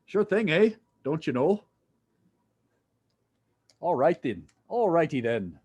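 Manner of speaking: mock Canadian accent, playfully put on; bad Canadian accent. Teacher teasing a student about being Canadian, bad Canadian accent. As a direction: ham up a poor Canadian accent. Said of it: "Are you Canadian?".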